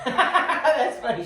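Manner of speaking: laughing hard while talking; high laughing burst; words squeezed out through a laugh. Real, not acted. Laughing at a funny remark.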